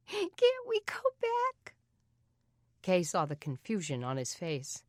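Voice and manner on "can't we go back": put-on very high, slow tearful little girl; little girl begging her brother to go back, tearful; sobbing little girl. A narrator's reading. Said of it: "hiccuping her sobs".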